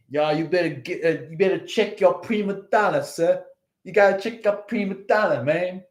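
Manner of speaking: fast, brash New York accent, lightly put on. Rich New Yorker telling off a diva in New York accent. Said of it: "that really rich New York accent".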